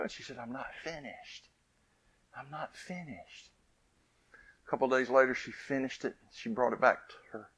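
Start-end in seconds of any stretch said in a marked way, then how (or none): 0.4-3.5 much softer, slow, patient mother; calm reassurance. Retold or imitated. Scene: mother calmly tells the upset girl she isn't done, soft and slow. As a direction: reassure her gently, slowly.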